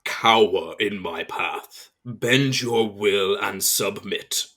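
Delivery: slow, commanding villain voice; booming voice. Acted. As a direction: boom it out slowly, lightly voiced. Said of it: "you hear a booming voice".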